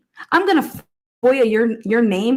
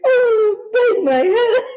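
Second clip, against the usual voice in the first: laughing; 11 semitones higher, slower than usual. Real, not acted. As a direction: wail it through laughter. Laughing at the lawyer.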